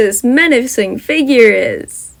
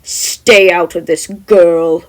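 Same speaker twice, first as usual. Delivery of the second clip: slow, louder menacing order; menacing command; 3 semitones lower, 6 dB louder, much slower than usual. Acted. The figure warns Jem off, slow and menacing. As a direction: lay down the law, slowly. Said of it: "the figure commands".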